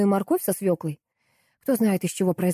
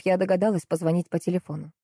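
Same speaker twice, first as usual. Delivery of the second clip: ordinary delivery, nothing marked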